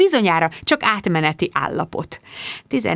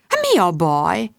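concerned mum; 2 semitones lower, slower than usual. Acted. Mum comforts upset daughter.

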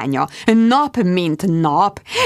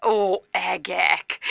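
slow, soft lament; despairing sigh; 5 dB quieter, much slower than usual. Acted. Jude lamenting softly on the phone. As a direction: moan it out.

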